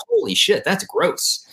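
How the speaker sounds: high, startled disgust; shocked disgust. Retold or imitated. Hearing the Michigan accent again, high shocked disgust. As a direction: recoil at the sound. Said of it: "I was like".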